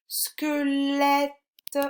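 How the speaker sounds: "Squelette" held high on the è; emphasised è sound. Shown as a demonstration. Teacher shows pupil the sound, è in "Squelette" emphasised. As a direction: stretch "-lette" in "Squelette" on a high pitch. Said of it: "on entend bien le est".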